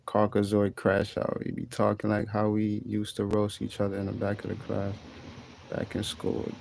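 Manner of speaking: soft, half-voiced read-out; reading a chat message quietly. Streamer quietly reading a viewer's message aloud. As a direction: murmur the viewer's comment to yourself. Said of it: "Kev Mercer said".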